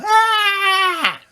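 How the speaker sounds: shrill falling scream; high scream. Acted. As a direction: scream as you fall, very high. Character falls screaming.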